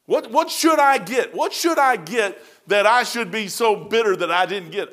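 loud and high, pressing the question hard; impassioned outcry. Real, not acted. Preacher to congregation, loud impassioned questioning. Preacher rising to a passionate peak.

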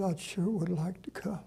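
whispered through tears; barely voiced, choked up; tearful whisper. Widow answering the pastor's invitation, tearful whisper. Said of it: "She started crying".

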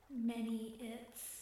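slow, breathy voice from the water. Acted. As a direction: breathe the words out slowly. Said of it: "The breathy voice came from".

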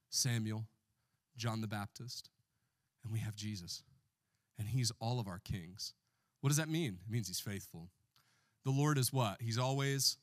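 ordinary talk, nothing marked

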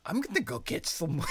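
high, breaking into laughter; Scottish surfer attempt. The cohost asked for a Scottish surfer accent. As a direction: try a Scottish surfer and crack up. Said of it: "What would a Scottish surfer sound like".

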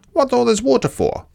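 high, quick platypus voice; high-pitched animal character question; curious platypus. A narrator's reading. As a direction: ask it high and fast as a storybook platypus.

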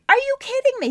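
high, loud stunned exclamation; stunned disbelief. Retold or imitated. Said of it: "I'm like".

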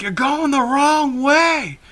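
high, drawn-out mock holler; retold scream. Quoting the uncle yelling from behind, mock scream. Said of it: "my uncle screaming behind me".

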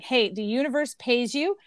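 usual speaking voice

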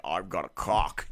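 deep, slow put-on voice; low, drawn-out character voice; Kenny. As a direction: drop it low and stretch it out. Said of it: "give the Kenny voice and be like".

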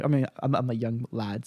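ordinary delivery

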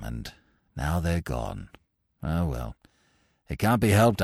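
low-pitched, mild upper-class chap; apologetic young chappie, resigned. A narrator's reading. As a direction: explain meekly, sinking into resignation.

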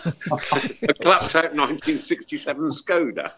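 slow, high, laughing through the words. Real, not acted. Host joking about his trade-in value, laughing. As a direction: crack up while delivering the punchline.

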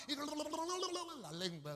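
slow high babbling of a wagging tongue; mock tongue rattle; tongue babble. Shown as a demonstration. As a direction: mimic a chattering tongue, drawn out and high. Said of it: "tongue rattling mimicry".

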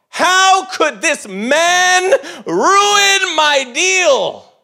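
loud, high, slow ranting wail with a laugh; mock-furious whine, dragged out and loud; furious outburst. Teen ranting about ruined truck deal, loud high mock fury. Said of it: "and I'm furious".

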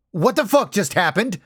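high, loud burst of alarm; startled exclamation. A narrator's reading. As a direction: exclaim it in shocked disbelief, pitched high. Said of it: "I heard Lucas exclaim".

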